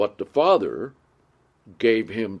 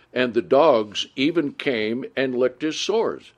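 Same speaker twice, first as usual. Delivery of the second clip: louder, measured Bible reading voice; scripture reading; 3 semitones higher, 3 dB louder than usual. Acted. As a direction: read the verses out to the congregation with weight. Reads scripture aloud.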